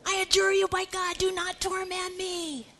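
tormented spirit pleading; begging demon. Acted. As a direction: beg in a high voice, drawing out the end. Acting the demon begging Jesus.

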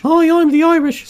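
very high, silly put-on Irish; mock Irish. Sample from a joke accent ad, mock Irish in high voice.

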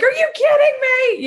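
high, indignant imitation of a parent; mock parental outrage. Voices parent's overreaction.

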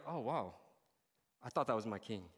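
low, deflated disappointment; let-down disbelief. Acted. Follower doubting the king on the cross, let-down disbelief.

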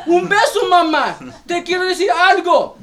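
put-on high, loud, desperate little boy begging for a kiss; pleading child. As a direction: cry out like a boy who won't let go. Child clinging to leaving mother.

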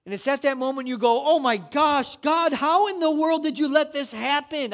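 rising to loud, high preaching; building sermon. Acted. Pastor urging the congregation, loud high preaching. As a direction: preach it harder and harder to the crowd.

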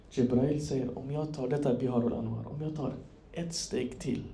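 very soft, low, with long pauses; hushed, low retelling of a solemn moment; hushed storytelling. Retold or imitated. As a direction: drop almost to a murmur at the climax. Hushed climax of retold ascension.

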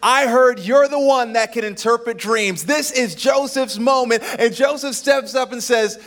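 loud, high preacher's build; preaching at full force. Acted. Pastor preaching to the congregation, full force. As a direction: preach it out to the church.

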